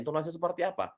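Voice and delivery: usual speaking voice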